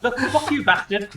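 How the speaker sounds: mock angry outburst. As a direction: snap it like a furious villager, half laughing. Villager curses the adventurers.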